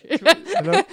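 high mock "Hello?" through a laugh; squeaky laughing hello. Echoing the silly greeting.

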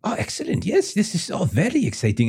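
put-on high, delighted French-accented voice; excited French parent. French parents hearing they'll see the plans, excited. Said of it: "they both look excited".

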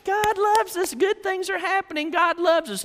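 very high mock cheering; hammed-up happy crowd; gleeful happiness. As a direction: squeal with childlike joy. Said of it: "They're just so happy".

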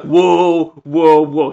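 loud halting whoa. Retold or imitated. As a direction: halt the party loudly. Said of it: "He was like".

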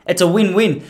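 ordinary delivery

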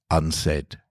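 ordinary delivery, nothing marked

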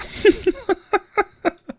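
a real laugh breaking out high; high burst of laughter. Real, not acted. The podcaster cracking up with real laughter after finishing the story. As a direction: crack up, unable to keep a straight face. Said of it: "I can't say nipples ... and keep a straight face".

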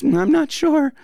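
high, hesitant mock voice of someone unsure; unsure cautious person. Voicing the cautious group's view, high, hesitant mock voice.

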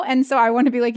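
ordinary delivery

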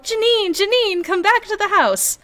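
high, calling mother voice. Retold or imitated. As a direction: call her home like a mom. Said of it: "maternal call of".

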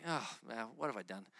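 low, soft dismay; hushed sinking realization; dismayed inner thought. Retold or imitated. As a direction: sink into regret, quietly. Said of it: "I'm like".

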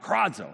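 high cawed kradzo like a crow. Shown as a demonstration. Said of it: "like the sound of a crow cawing out".

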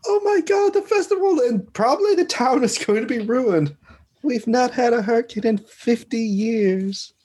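high-pitched panicked wail of a crowd; panicking townsfolk. Acted. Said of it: "everyone starts panicking".

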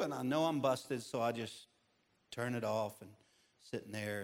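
normal talking voice

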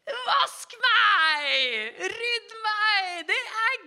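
high, loud, whining pleas from the chores; housework calling out. Acted. The housework calls to the praying pastor in a high, loud whine. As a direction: shriek it like the messy house begging to be cleaned. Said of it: "det roper til meg".

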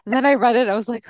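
ordinary talk

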